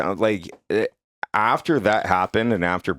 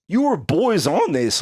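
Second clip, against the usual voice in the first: defensive protest; 11 semitones higher, 3 dB louder, slower than usual. Retold or imitated. Defends himself to angry informant.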